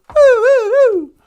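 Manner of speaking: high, loud siren wail; imitated police siren. Storyteller announces the police coming, imitated police siren. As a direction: woo like an approaching squad car.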